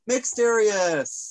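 slow, loud, high host announce; drawn-out announcement. Acted. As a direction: stretch the name out like a showman.

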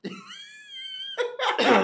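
breaking into high laughter; words lost in a laugh. Real, not acted. Reading the joke name, cracking up.

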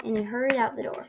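plain reading voice

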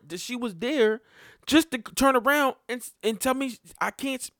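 high, loud, heated outburst; angry rant. Retold or imitated. Venting anger at baby mom.

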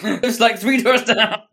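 high, loud mock voice; mock character voice. Mocking the spoiler-blurting Frank, high and loud. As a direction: ham up the blabbing character.

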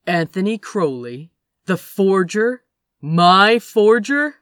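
rising outrage; incredulous. A narrator's reading. Growing incredulous anger.

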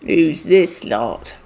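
low, slow goblin voice; gruff goblin voice. Acted. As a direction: growl it out like a suspicious guard. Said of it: "one of them ... goes".

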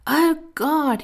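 high, drawn-out moan of self-pity. Acted. Broke man moaning to God, a high self-pitying moan. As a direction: groan it up to heaven. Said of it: "he moaned".

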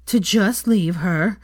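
slow, loud, out of breath; panting between words; gasping. Acted. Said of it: "another gasp".

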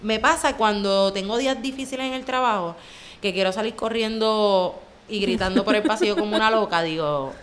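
ordinary talk, nothing marked

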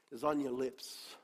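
ordinary delivery

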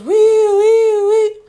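high loud siren wail; siren imitation. Imitating the cop siren behind them. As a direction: mimic the police siren whooping.

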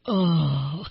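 long drawn-out sarcastic oh; sarcastic, falling "oh". Acted. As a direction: groan it out with mock dismay. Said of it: "in a sarcastic way".

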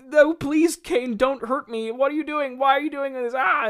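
high, loud, hammed-up pleading for life; mock terrified cries of the victim; Abel pleading. Abel begging his brother Cain not to kill him, hammed-up panic. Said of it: "him kicking his brother down".

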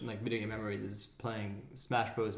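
normal talking voice

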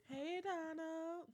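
slow, high drawn-out hello; drawn-out greeting. Acted. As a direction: stretch the hello out slowly. Shout out to brother.

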